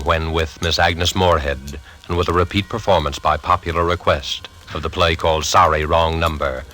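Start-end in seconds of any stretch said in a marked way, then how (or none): none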